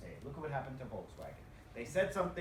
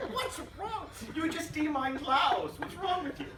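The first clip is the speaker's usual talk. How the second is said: much higher, laughing shriek of disbelief, slow in the middle; 13 semitones higher, 6 dB louder, slower than usual. Retold or imitated. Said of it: "you can imagine my".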